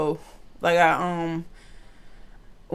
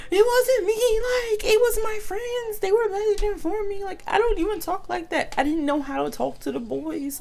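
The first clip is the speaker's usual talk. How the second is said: much higher, begging to be believed; pleading innocence; 14 semitones higher than usual. Retold or imitated. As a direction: insist it wasn't you, pitched way up. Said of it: "and I'm like".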